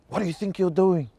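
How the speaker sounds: high, scolding disbelief; incredulous friend. Retold or imitated. Friend Pania demanding why she's just standing there crying, incredulous and high. Friend whacks her mid-tears.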